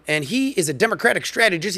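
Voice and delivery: usual speaking voice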